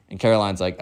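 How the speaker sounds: plain reading voice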